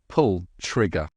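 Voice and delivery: usual speaking voice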